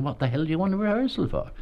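high-pitched, quick mock exasperation; incredulous retort. [name] to young actor asking for rehearsal, incredulous retort. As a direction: act out a scornful, disbelieving comeback. [name] repeats his scoff.

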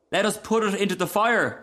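put-on high, eager child; little boy's voice. A narrator's reading. Boy suggesting fuel for the fire, high and eager. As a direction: propose it like a small boy. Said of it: "said the other boy".

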